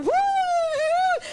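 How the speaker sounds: high mock "uh, uh" moans breaking into a laugh; high laughing mock of ecstatic moans; mocking worshipper noises. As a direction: send up their ecstatic groaning, laughing at it. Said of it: "e eles começaram".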